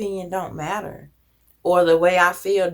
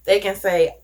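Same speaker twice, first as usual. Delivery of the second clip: ordinary delivery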